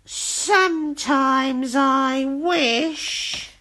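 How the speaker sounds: very slow, longing witch voice; drawn-out wistful wish. A narrator's reading. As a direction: let it trail wistfully. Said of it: "she sighed".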